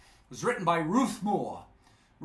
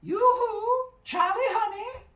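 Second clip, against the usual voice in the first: tinkly little voice; 4 dB louder than usual. Acted. Little mermaid calling to Charlie, tinkly and sweet. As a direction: coo it like a teasing little mermaid. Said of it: "the same sweet voice".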